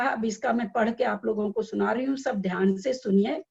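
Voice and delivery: normal talking voice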